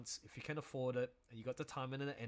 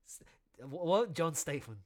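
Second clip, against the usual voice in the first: usual speaking voice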